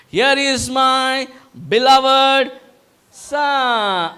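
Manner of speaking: a lighter take on the arena introduction; announcer voice. Acted. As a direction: announce it grandly, slowing down. Repeats the Father's proclamation.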